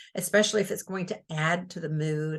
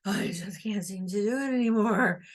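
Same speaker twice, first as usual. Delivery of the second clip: whole line breathed out on a long sigh. Shown as a demonstration. Teacher demonstrates a character sighing the entire line, a weary sigh. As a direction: sigh through every word, weary. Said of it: "she sighed that entire line".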